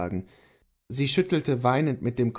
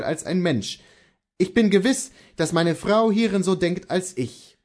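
earnest, higher-pitched husband; Kohlhaas the husband; 7 semitones higher, 3 dB louder than usual. Acted. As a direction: answer your wife with firm conviction.

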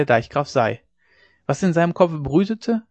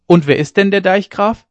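loud, higher-pitched challenging question; calling out; 6 semitones higher, 6 dB louder than usual. A narrator's reading. Ole Hensen questioning the men in the room, calling out. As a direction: throw the question out to the whole room. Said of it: "rief Ole Hensen wieder".

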